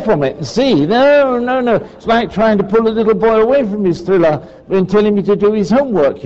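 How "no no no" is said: dog resisting. Retold or imitated. Dog resisting being pulled away.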